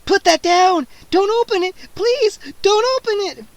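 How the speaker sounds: high, loud terrified plea; frightened begging. A narrator's reading. Scene: the vampire begs Freddy not to open the bottle, terrified. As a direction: beg in fear.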